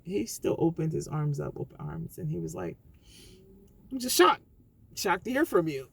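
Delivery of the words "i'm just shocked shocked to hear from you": startled and raised voice; surprised. Retold or imitated. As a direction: voice jumping up in shock. Retold friend's shocked greeting.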